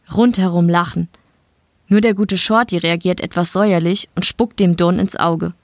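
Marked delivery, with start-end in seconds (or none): none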